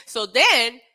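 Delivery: normal talking voice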